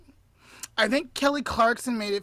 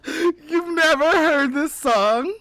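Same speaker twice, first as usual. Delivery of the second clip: laughing, louder and higher; laughing disbelief; 6 semitones higher, 8 dB louder, slower than usual. Real, not acted. Shocked friend asking about the song, laughing. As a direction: laugh through the question.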